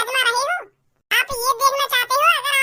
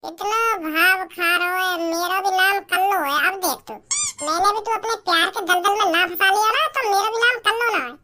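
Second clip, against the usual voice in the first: scheming aside; 7 semitones lower than usual. Acted.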